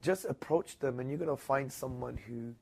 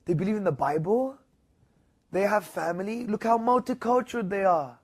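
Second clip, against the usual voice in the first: amazed praise; 7 semitones higher, 5 dB louder than usual. Retold or imitated. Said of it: "And he was, like, shocked".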